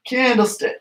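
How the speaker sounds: candlestick said high while rocking back; strained demo voice. Shown as a demonstration. Coach demonstrating candlestick to kids, strained high. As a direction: squeeze candlestick out high as legs go up. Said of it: "you're gonna go like this".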